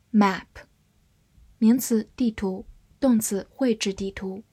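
normal talking voice

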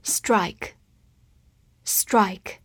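very slow, drawn-out repeated word; slow review drill. Shown as a demonstration. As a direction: stretch each word for learners. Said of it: "复习一下今天学过的单词". (慢速复习单词)

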